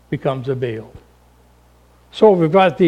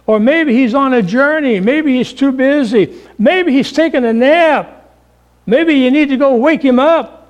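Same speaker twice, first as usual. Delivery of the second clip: high, taunting preacher voice; mocking; 6 semitones higher than usual. Elijah taunting the prophets of Baal, high and mocking. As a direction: sneer at them from above. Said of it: "Elijah mocked them and said".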